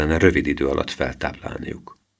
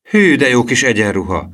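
high, loud envious admiration; 6 semitones higher, 5 dB louder than usual. A narrator's reading. Gerold eyeing the green uniform, envious. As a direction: exclaim with envy, pitch and volume up. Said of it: "mondta Gerold irigykedve".

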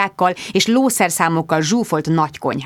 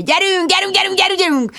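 yelling; 12 semitones higher than usual. Acted. Producer yells at Bridget to start, frantic. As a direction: hurry her with a shout. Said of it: "ordította Richard".

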